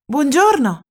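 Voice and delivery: friendly hello with a smile; smiling greeting. Acted. Elmer smiling back at the animals, friendly hello. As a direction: answer kindly, smiling. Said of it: "rispondeva sorridente".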